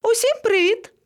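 very high, sing-out greeting; calling down. A narrator's reading. Monkey greeting animals from a tree, calling. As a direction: hail everyone in a high voice.

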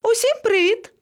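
high, rising cheery greeting; high-pitched little monkey call; calling from above. A narrator's reading. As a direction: hail everyone in a very high voice. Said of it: "згори раптом долинув чийсь голос".

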